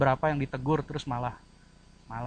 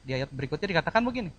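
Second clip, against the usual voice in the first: ordinary talk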